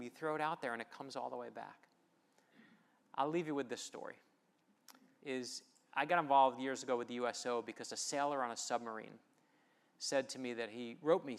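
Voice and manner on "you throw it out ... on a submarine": ordinary talk, nothing marked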